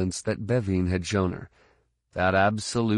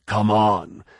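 louder, forceful call; urgent urging; 5 dB louder than usual. Acted. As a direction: urge her on forcefully. Urging stuck Tahiri up the tube.

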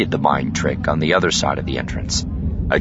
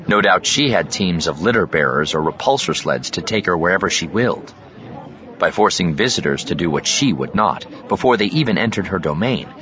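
plain reading voice

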